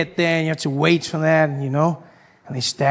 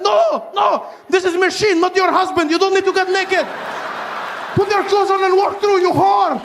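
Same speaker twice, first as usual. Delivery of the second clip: scolding guard; 12 semitones higher than usual. Retold or imitated. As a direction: berate her in disbelief. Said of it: "She's like".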